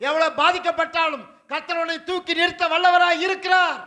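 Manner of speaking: loud, climbing sermon proclamation; fiery preaching. Acted. Pastor thundering to the congregation about the furnace, loud and fervent. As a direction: preach it out with rising fire.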